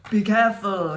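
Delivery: warning. Acted. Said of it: "he warned".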